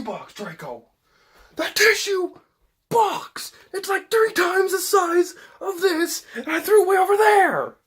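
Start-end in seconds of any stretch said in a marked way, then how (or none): 1.6-7.8 indignant. Real, not acted. Indignant about her tissue box throw.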